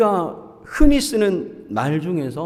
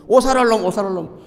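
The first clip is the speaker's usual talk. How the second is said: put-on old folks cursing; 4 semitones higher, faster than usual. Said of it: "옛날 사람들은".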